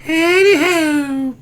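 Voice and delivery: very high, slow, stretched Heidi ho with a cry in it; silly falsetto heidi ho. Acted. Host echoes a chatter's greeting in a high stretched falsetto. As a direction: sing it back way up high and drawn out.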